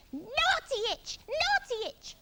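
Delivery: high parrot squawk; Parrot scolding. A narrator's reading. Parrot flapping at Iggy's itch, squawky scolding. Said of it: "flapped Parrot".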